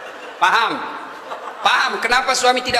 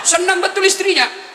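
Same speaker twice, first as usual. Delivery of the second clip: emphatic, laughing; 10 semitones higher than usual. Shown as a demonstration. Preacher repeats the wife's joy with laughing emphasis. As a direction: play up how thrilled the wife is.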